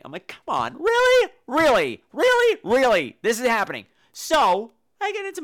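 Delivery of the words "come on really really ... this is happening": high, loud, repeated disbelief; can't believe this is happening; incredulous. Retold or imitated. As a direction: comedian hamming up his disbelief. Incredulous reaction.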